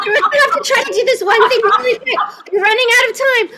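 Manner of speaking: fast, high and bright hurry; rushed excitement. Real, not acted. Host urging players to hurry, fast and breathless. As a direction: hurry them along before time runs out.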